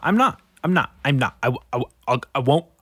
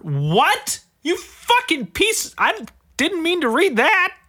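shocked outburst; 5 dB louder, slower than usual. Real, not acted. Shocked by dead bulldog headline.

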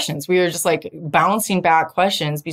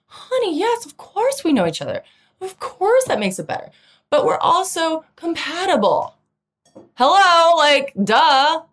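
high, sassy put-on boyfriend voice; teasing boyfriend; 8 semitones higher than usual. Boyfriend teasing her insecurity, high sassy voice. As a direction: play him as sweetly exasperated.

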